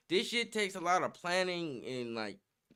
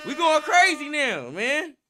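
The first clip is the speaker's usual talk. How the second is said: hyped up; 9 semitones higher, 7 dB louder than usual. Real, not acted. Hyped by horns.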